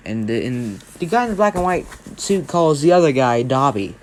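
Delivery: ordinary delivery, nothing marked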